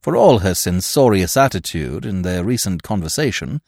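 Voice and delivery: plain reading voice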